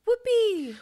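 high, loud whoop of a greeting; gleeful greeting. Pretend. Pretend greeting on meeting somebody, gleeful whoop. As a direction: cry it out as a playful, high-pitched hello. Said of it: "when you meet somebody, you can go".

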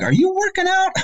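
mock-incredulous, pitch leaping very high; coworkers' incredulous question. Coworkers calling out the huffing on a call, mock incredulous. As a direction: imitate colleagues catching you out in a high squeal. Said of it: "They're like".

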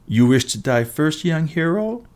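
lightly voiced taunting giant; taunting question. A narrator's reading. Giant mocking the young hero, rising taunt.

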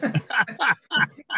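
a high, bright burst of laughter at himself; amused laugh. Real, not acted. Laughing at his naive younger self, a high laugh. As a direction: laugh at your own naivety.

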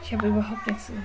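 entsetzt geflüstert. Real, not acted. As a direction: leise hauchen, fassungslos. Nach dem Tod, Sachen weg. (fassungsloses Flüstern)

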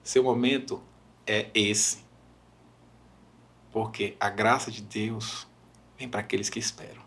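low, soft, weighty pauses; imitated solemn reassurance. Retelling [name] encouraging a mother, quiet and solemn. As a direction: let each phrase land gently.

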